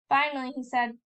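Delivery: ordinary delivery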